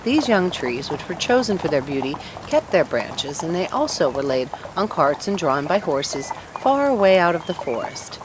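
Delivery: ordinary delivery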